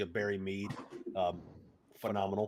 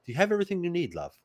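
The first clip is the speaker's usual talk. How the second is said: lightly voiced motherly host, a bit higher; motherly innkeeper; 8 semitones higher, much faster than usual. Innkeeper asking the traveller if he needs anything, motherly. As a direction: lean in and ask like a caring aunt. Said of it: "in the most, like, motherly".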